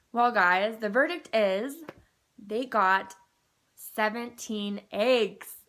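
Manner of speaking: loud, slowed announcement of the news; proud dramatic reveal to the camera; excited reveal. Real, not acted. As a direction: build it up, then land seventeen eggs. Big news reveal to viewers.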